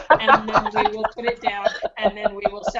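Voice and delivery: chuckling through the words; laughing. Real, not acted. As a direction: laugh as you talk. Laughing at own joke.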